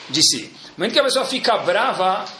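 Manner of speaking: normal talking voice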